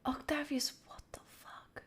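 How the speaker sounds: voiceless, barely audible whisper; shocked whisper. Real, not acted. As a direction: whisper it, stunned speechless. Stunned by repeated gifts.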